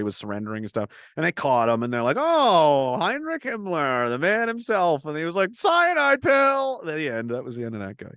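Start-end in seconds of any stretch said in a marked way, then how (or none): 2.2-5.0 higher and slow, savoring the name; a hammed-up gotcha greeting; mock gloating captors. Captors mockingly greet Himmler. 5.5-6.8 much higher, louder, drawn out very slowly; a comic theatrical exit cry; mock dramatic last words. Himmler's last words, acted out.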